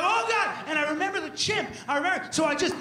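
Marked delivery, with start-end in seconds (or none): 0.0-0.5 mock panic. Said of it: "And I was like".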